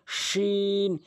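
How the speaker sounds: long "শিন", middle of tongue to the palate; mid-tongue shin. Shown as a demonstration. Teacher showing where the letter is made, drawn-out "শিন". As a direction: stretch "শিন" slowly. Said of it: "জিহ্বার মাঝখান উপরে".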